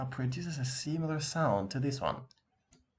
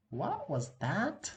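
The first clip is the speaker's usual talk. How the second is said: teacher prompt; 9 semitones higher, much slower than usual. Shown as a demonstration. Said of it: "teacher prompting the viewer".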